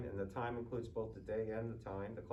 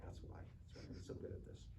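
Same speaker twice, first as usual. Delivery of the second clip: hushed mock boast, much softer; whispered brag; 11 dB quieter, faster than usual. Student jokes he learned from Mister Becker, whispered mock boast. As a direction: tuck the brag away in a whisper.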